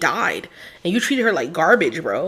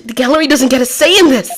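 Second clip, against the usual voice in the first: higher, louder and fast objection; 7 semitones higher, 6 dB louder, faster than usual. Acted. Defense lawyer loudly tells the court the gallery has no say. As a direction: snap it out fast and loud.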